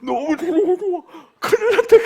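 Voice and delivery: high, loud, laughing panic; mock alarm, laughing as he tells it; panicked while laughing. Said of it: "그런 생각이 나요". (웃으며 높고 크게 흉내 내는 당황)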